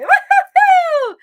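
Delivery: long, high, loud woo-hoo-hoo; excited whoop. Shown as a demonstration. Coach showing viewers a sound and movement, loud, joyful whoop. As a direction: let the joy burst out as a sound. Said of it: "Sound and movement".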